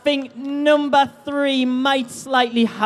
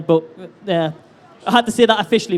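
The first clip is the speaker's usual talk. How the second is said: normal talking voice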